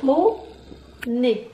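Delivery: "Poney" split as po, ney; syllable by syllable. Shown as a demonstration. Teacher counting with the class, "Poney" in two separate syllables. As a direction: pause between the two beats of "Poney". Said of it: "On y va ensemble".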